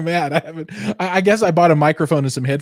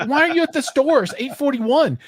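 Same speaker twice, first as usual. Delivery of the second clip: very high, exasperated retold question; mock incredulity; 14 semitones higher than usual. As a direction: squeal it in put-on disbelief. Retold incredulous reply to friend.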